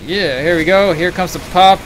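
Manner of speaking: loud, high hype call; excited call. Real, not acted. Caster hypes an uber pop.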